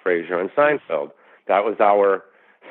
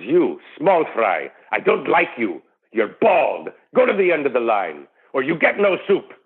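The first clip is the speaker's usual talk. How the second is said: improvised Soup Nazi; 4 semitones higher than usual. Pretend. Improvising the Soup Nazi for his friend, a light Middle Eastern accent. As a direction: sneer at the customer, then snap out the no-soup threat. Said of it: "improvise it".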